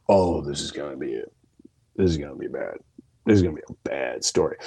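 low inner voice bracing for bad news; retold sinking dread. Inner dread, expecting confession.

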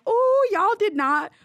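high, drawn-out mock shock; scandalized reaction. Man reacting to brutal online comments, scandalized.

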